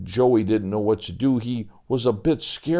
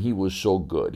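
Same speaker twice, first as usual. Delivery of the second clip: usual speaking voice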